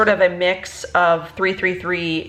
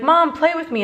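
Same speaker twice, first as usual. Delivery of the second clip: high little-kid voice imitation; lightly voiced whiny toddler; imitating her son; 9 semitones higher than usual. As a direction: mimic a small child begging to play. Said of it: "he's like".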